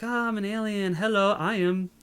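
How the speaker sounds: high-pitched, naively friendly alien greeting; mock-sweet superheroine hello; friendly Supergirl. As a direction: introduce yourself in a high, innocent voice. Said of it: "she's all friendly and stuff".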